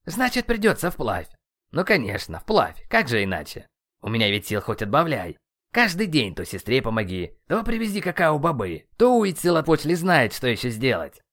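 exasperated, sarcastic tirade; worked-up, aggrieved griping; ranting. A narrator's reading. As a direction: vent your grievances one after another. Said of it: "продолжал психовать Кецалькоатль".

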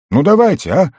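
put-on high, loud childlike begging; eager pleading. A narrator's reading. Restless goblin pleading with friends to go fishing, eagerly.

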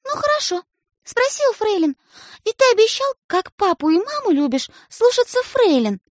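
very high, insistent; reproachful reminder. A narrator's reading. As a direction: hold him to his word. Sister reminds of promise.

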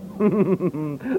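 rising chuckle; amused laugh. Acted. Robert laughs at Freddy's remark, amused chuckle.

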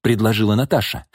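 ordinary delivery, nothing marked

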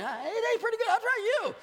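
very high, comic lion voice; high-pitched cartoonish lion impression; voiced lion. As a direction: play a hungry lion in a high squeal. Voices lion tasting a head.

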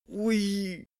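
high, drawn-out hungover moan; long high-pitched "ooy" of pain; pained groan. A narrator's reading. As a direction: moan as if clutching an aching head. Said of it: "хватаясь за голову".

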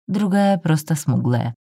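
plain reading voice

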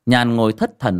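usual speaking voice

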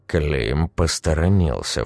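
ordinary delivery

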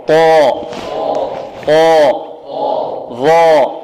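"خاء، صاء، ضاء، غاء، طاء، ظاء" each full-mouthed, heavy and held; heavy letters drill. Shown as a demonstration. Teacher leads class repeating after him, heavy emphatic letters. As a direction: sound out each letter heavily, pausing after it. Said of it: "انطقوا معي".

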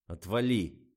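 loud, curt brush-off; irritated, gruff dismissal; gruff grunt. A narrator's reading. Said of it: "буркнул Фомин".

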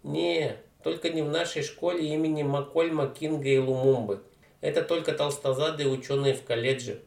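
put-on slangy street teen, loud and cocky; a brash teenage punk mangling words; дерзкий уличный парень. Street kid voice. (громкий развязный подросток)

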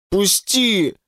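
strained, pleading groan; narrated moan, mildly acted. A narrator's reading. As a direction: moan it as a plea. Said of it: "стонал Вова".

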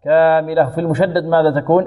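usual speaking voice

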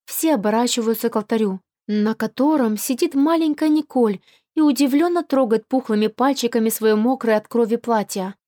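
ordinary delivery, nothing marked

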